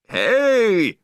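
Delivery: high, loud angry bark; angry shout. A narrator's reading. Beachgoer seeing the dog poop, angry shout. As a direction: yell at the offender. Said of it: "somebody shouted angrily".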